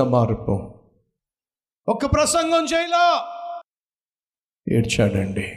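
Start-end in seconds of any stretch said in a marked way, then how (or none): 1.9-3.3 high, ringing shout from the pulpit; preacher's shout. Real, not acted. Preacher shouts to the church about one sermon, full-voiced cry. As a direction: cry the words out to the congregation.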